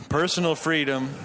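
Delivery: ordinary talk, nothing marked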